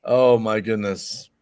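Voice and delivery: slow words through laughter; laughing. Real, not acted. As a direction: laugh it out, slowly.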